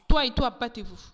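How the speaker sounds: loud order to fight; acted command. A demon sets two people on each other, loud command.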